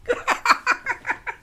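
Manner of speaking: bright burst of laughter; high laugh. Real, not acted. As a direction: laugh freely.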